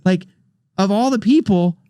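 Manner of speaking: high, squeaky awestruck gush; rising high starstruck squeal; giddy disbelief. Retold or imitated. Disbelief at [name] hugging him.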